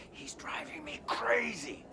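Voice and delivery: whispered complaint about Wayne, under the breath; whispered aside. Real, not acted. Tired traveller whispering a complaint about Wayne. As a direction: lean in and whisper it like a secret.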